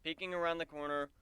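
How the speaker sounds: plain reading voice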